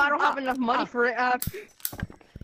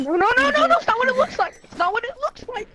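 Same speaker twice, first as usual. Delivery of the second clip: panicked pleading; 7 semitones higher than usual. Pretend. Panicked pleading before execution.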